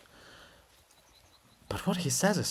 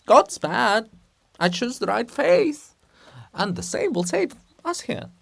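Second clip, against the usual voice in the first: bluff reassurance; 6 semitones higher, 5 dB louder than usual. Acted. Volodymyr cheers up grieving Olha.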